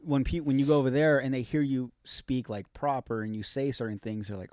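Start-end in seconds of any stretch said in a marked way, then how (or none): none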